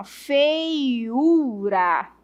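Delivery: very slow, stretched-out "feiura"; "feiura" spoken slowly in separated syllables; syllable-by-syllable demonstration. Shown as a demonstration. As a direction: draw out each syllable of "feiura", the u held long. Teacher demonstrates word syllable by syllable.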